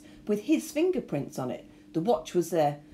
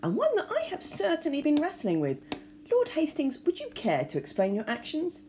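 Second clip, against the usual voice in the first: inspector voice; 9 semitones higher than usual. A narrator's reading. Inspector addresses Lord Hastings.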